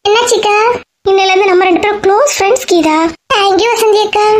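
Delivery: put-on young Geetha.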